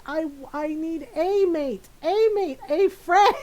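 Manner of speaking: high, loud, drawn-out clarification; David Tennant. Retold or imitated. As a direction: spell it out, imitating exasperation. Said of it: "He's like".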